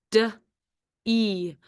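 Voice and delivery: D-E as separate sounds "duh, ee"; sounded out. Shown as a demonstration. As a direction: say D-E as a short "duh", pause, long "ee". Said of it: "D is your second syllable".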